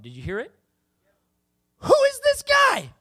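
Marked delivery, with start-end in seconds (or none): 1.8-2.9 very high, loud incredulous cry; high-pitched mock outrage at a rival; indignant outburst. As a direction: shout it in disbelief, pitched way up. Paraphrasing David's outrage at Goliath.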